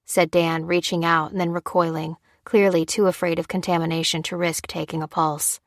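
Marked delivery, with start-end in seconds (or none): none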